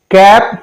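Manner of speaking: कैप with a short open "a". Shown as a demonstration. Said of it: "short sound हो गया". (कैप में छोटा ऐ)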